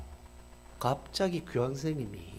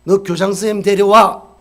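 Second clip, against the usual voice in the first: loud shouted order; angry command; 2 semitones higher, 16 dB louder than usual. Retold or imitated. Shop owner orders the kids to fetch their principal, shouting. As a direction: bark the demand at them.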